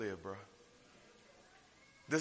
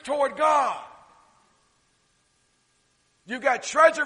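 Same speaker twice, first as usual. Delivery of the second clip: loud, slow, ringing pulpit delivery; full-force preaching; 7 semitones higher, 12 dB louder, slower than usual. Acted. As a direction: preach it out big and drawn out. Preaching the moral, raised voice.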